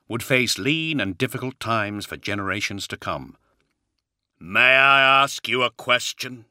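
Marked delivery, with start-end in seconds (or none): 4.4-6.4 booming, heavy warrior voice. Acted. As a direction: speak like a towering giant. Primarch asks Johnson.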